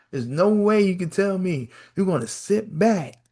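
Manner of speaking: usual speaking voice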